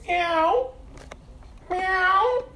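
high, odd mewing cat voice; cat meow. Retold or imitated. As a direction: meow like a cheeky cat in formation. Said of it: "I went".

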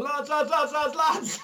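high urgent calling. Retold or imitated. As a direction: call the boys over urgently. Guy calling out to boys.

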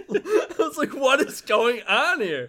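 baffled laughter, slowed down; laughing. Real, not acted. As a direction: laugh through the question.

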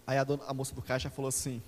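ordinary delivery

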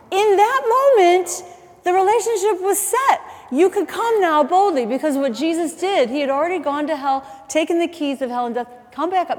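ringing declaration that gradually eases; impassioned preaching. Real, not acted. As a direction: proclaim it to the congregation with force. Preaches at full force.

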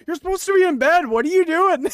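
loud, high mock telling-off; imitated parental outburst in a thick accent; imitating Porgy scolding. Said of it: "his thick, his thick accent saying".

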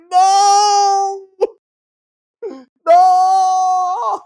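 mock anguished wail; anguished cry. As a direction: wail in laughing despair. Watching gnome fly off windmill.

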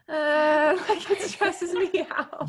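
high, laughing mock stress. Friend laughing about skincare stress. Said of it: "I'm like".

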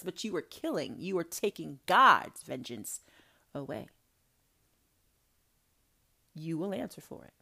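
ordinary delivery

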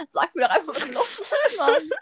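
high-pitched plea breaking into laughter; giggling while begging her to spill it; laughing, exasperated. Retold or imitated. To a crying friend who won't explain, laughing exasperated plea. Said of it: "Ich so".

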